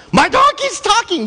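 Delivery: very high loud surprised exclaim; mock astonishment. Balaam noticing his donkey talking, loud high surprise. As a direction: blurt it out amazed. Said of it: "It's like".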